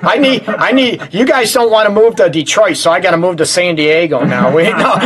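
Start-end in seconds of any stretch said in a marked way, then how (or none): none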